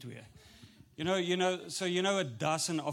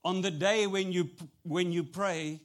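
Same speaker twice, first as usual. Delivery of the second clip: ordinary talk, nothing marked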